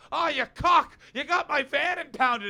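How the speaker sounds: put-on high, loud, slowed-down yell in a Leeds accent. A dad from Leeds yells at his son over the impounded van, loud and high in a Leeds accent. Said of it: "but when he yells".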